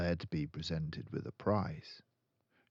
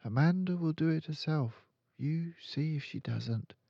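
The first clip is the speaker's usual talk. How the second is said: put-on a calm, certain young girl; Matilda's voice; 10 semitones higher than usual. Matilda correcting her friend, in a high girl's voice.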